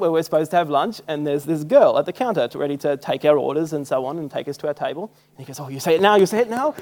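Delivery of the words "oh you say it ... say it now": excited urging, rising higher and louder on the repeat; eager prompting. Retold or imitated. Tour guide to his pupil at the restaurant, eager prompting. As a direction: nudge him insistently, with a rising question.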